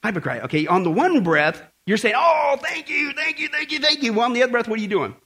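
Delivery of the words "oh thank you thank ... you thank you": gushing mock thanks.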